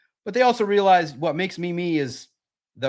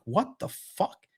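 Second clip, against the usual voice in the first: slow stunned whisper; 4 semitones higher, 6 dB quieter, much slower than usual. Retold or imitated. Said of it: "I was like".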